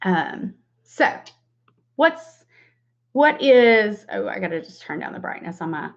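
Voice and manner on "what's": usual speaking voice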